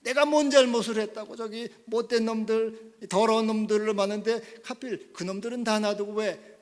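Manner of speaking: loud, high, indignant complaint; acted anger. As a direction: rail against fate as the stricken patient. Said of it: "화를 냅니다".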